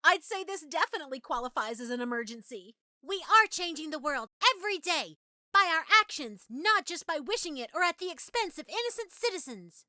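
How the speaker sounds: loud, high-pitched, forceful protest; heated, ringing declaration; impassioned outburst. Acted. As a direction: raise the voice and drive each phrase hard. Impassioned speech in an acted monologue.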